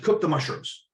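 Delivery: put-on heavy German accent. German master chef ordering his young cook, in a heavy German accent. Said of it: "in his heavy German accent".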